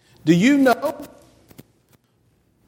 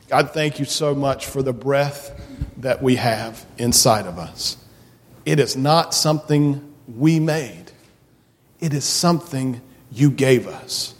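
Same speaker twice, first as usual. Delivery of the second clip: prayer; 4 semitones lower than usual. Real, not acted. Pastor praying with the children, gentle and lower.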